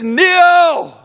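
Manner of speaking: loud, high one-word command; commanding shout. Acted. God ordering Saul down, a loud command. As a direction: bark the word down at him. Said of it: "He says".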